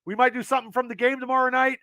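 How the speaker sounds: usual speaking voice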